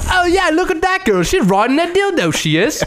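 high, hammed-up British bloke accent; mock British accent. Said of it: "limey bastards just sitting around like".

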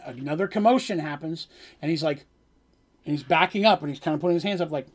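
ordinary talk

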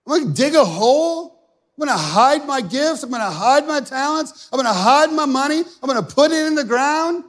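loud, high, defiant selfish servant. Acted. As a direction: sneer out the selfish man's plans. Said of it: "this person is".

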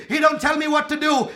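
ordinary delivery

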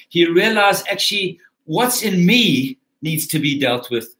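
ordinary delivery

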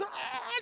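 high, soft imitation of screaming; mock scream.

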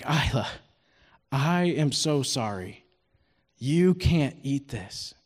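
low, slow and soft apology; hushed, regretful let-down; sorry dad. Retold or imitated. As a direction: break bad news gently to a little girl. Dad apologises over moldy blueberry.

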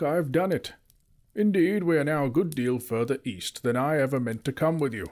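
pleased with himself, lift it up; wizard voice. A narrator's reading. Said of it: "said the wizard".

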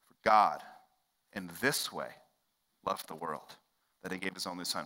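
"For God", "in this way", "loved the world" split by pauses; phrase by phrase. Shown as a demonstration. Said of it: "repeats verse phrase by phrase".

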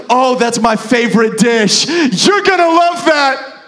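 gushing mock-server voice, loud; overjoyed waitress. Waitress praising a customer's dish choice, over-the-top happy. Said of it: "She's so happy".

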